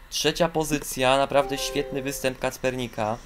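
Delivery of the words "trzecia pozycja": ordinary talk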